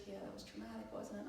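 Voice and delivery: soft, hushed sympathy; quiet, knowing reassurance; soothing healer. Retold or imitated. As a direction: imitate a gentle healer consoling someone. Healer soothing her, retold.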